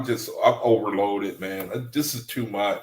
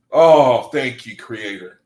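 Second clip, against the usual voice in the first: ordinary talk, nothing marked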